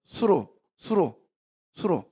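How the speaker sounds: 수루 drawn out slowly and softly; Hebrew word sounded out. Shown as a demonstration. Preacher slowly sounds out the Hebrew 수루 for the congregation. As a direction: spell out 수루 syllable by syllable. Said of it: "수루란 뜻이야".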